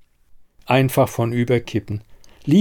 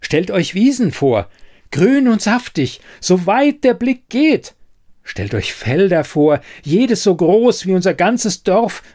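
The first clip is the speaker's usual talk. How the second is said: excited; 8 semitones higher than usual. Acted. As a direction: sell the dream to doubters. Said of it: "aufgeregt anredete".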